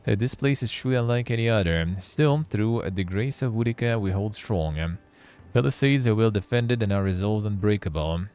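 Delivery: earnest soldier writing home; dramatic letter reading. Acted. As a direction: read the letter with feeling. Reads soldier's letter aloud.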